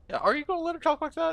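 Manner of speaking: quick, very high-pitched needling; mock goading. Player goading companion about his sister, high mock outrage. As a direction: egg them on in a high, hurried voice. Player repeats the goad, higher.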